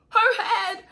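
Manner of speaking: high, loud incredulous echo; shocked disbelief, imitated. Labouring woman reacting to nurse, imitated disbelief. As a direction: squeal it back as an acted startled question. Mother echoes the shocking news.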